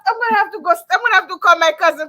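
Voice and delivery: high, loud, fast and amused; excited laughing rush. Real, not acted. Host joking she'll phone her cousin, excited. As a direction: rattle it off, high and gleeful.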